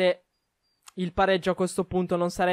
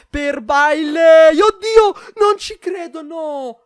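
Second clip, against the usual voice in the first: high, loud near-goal cry; excited shout; 7 semitones higher, 9 dB louder, much slower than usual. Real, not acted. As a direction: shout in disbelief at the chance. Near miss, huge chance. (grido incredulo)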